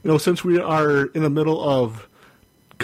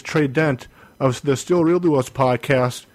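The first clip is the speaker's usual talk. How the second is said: normal talking voice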